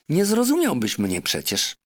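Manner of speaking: a clever parrot lecturing her master. Acted. Parrot Polynesia urging the doctor, high and insistent.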